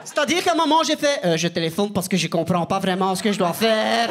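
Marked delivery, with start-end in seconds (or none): 1.2-4.1 French with a put-on Flemish accent. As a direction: speak halting French like a Fleming, lower-pitched. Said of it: "parler en français avec un accent flamand".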